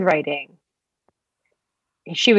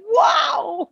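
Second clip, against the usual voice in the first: amazed; 9 semitones higher than usual. Retold or imitated. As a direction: gasp it in shock. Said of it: "I'm like".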